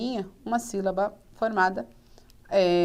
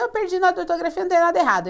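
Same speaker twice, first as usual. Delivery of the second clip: complaining student; 6 semitones higher, much faster than usual. Retold or imitated. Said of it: "Aí você vai lá olhar e fala assim".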